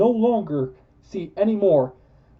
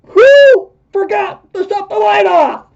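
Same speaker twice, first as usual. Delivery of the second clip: shouting; 12 dB louder than usual. Real, not acted. Shouting about the light.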